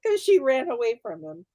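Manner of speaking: normal talking voice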